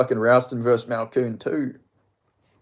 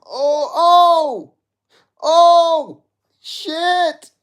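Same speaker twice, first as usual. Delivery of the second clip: high, loud stunned cries; shocked yelps; 12 semitones higher, 6 dB louder, much slower than usual. Real, not acted. Fan watching his fighter land shots, stunned yelling. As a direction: gasp out loud at the punches. Fan reacting to big punches.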